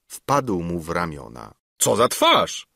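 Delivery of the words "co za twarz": louder, higher cry of surprise; startled exclamation. A narrator's reading. Councillor sees his friend's paint-stained face, exclaiming. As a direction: react aghast, voice raised. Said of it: "zawołał".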